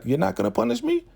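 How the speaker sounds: quick, squeaky high question; hopeful surprise. Retold or imitated. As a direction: imitate someone amazed to be spared, very high. Acted surprise at being spared.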